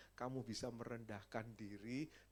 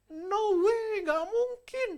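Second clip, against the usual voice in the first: much higher, much louder, disbelieving cry; shocked refusal; 18 dB louder, slower than usual. Retold or imitated. Nurse refusing the patient's request to go home, in shocked disbelief. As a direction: squeal it like an outraged nurse. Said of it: "Dia ngomong".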